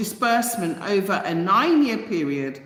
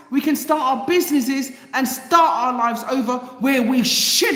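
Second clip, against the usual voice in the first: heated demand with raised pitch; fired-up, higher-voiced angry protest; real anger; 5 semitones higher than usual. Real, not acted. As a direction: build an angry, insistent case. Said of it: "getting angry a lot this week".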